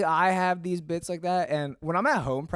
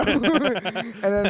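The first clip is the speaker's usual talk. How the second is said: ordinary talk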